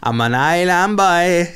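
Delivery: slow, high and loud, drawn-out sing-song. Retold or imitated. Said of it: "The Island Boys".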